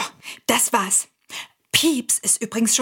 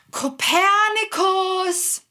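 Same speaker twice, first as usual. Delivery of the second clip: long, slow, high call across the house; 8 semitones higher, much slower than usual. Acted. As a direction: call his name to the far rooms. Calling the cat through the house.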